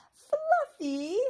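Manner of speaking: high, loud delighted cry. A narrator's reading. Fern spotting her squirrel friend, high delighted cry. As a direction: squeal his name with joy.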